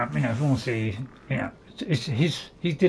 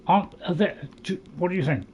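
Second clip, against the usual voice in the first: ordinary talk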